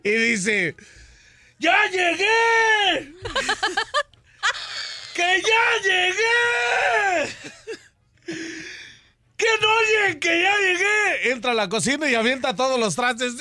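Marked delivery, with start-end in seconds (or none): 1.6-11.3 put-on high, long-drawn drunken holler; drunk man yelling. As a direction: stretch the vowels, calling out to the house. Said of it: "Y grita".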